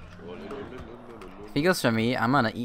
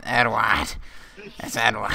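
correcting the name through gritted teeth; through clenched teeth; 3 semitones lower than usual. Retold or imitated. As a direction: grit the teeth and correct him. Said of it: "he says it through clenched teeth".

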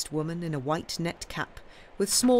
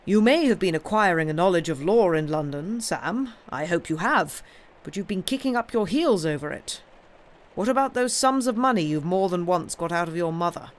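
lightly voiced uncle, firm and louder; stern scolding uncle; 3 semitones higher, 6 dB louder than usual. Uncle confronting Sam over money, lightly acted scolding. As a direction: take a young nephew to task.

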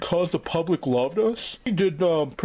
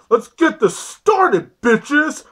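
higher, louder, slow hype call; hyped kickoff; 9 semitones higher, 6 dB louder, much slower than usual. Acted. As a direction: stretch it out like a show opener. Hyping start of the show.

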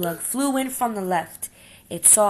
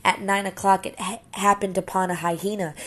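ordinary delivery, nothing marked

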